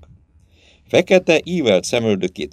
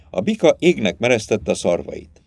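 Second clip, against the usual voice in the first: plain reading voice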